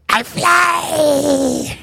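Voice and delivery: tiny high squeaky cry of joy; pixie. Acted. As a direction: shout it like a gleeful little sprite. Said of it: "And you hear a little voice say".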